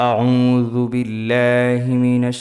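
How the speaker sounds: slow, drawn-out chanted recitation; Quranic recitation. Shown as a demonstration. Teacher opening the lesson with slow chanted recitation. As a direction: intone it slowly like tilawat. Opens recitation with taawwuz, basmala.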